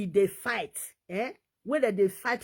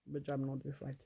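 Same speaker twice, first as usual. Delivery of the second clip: much softer, lower repeat of the same line; quiet echo; 10 semitones lower, 15 dB quieter, faster than usual. Real, not acted. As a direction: drop it to a murmur to yourself. Defending brother, then echo.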